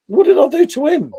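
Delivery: high, loud indignant question; loud mock outrage at being snubbed; baffled outburst. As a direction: throw the question up in disbelief. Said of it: "I was like".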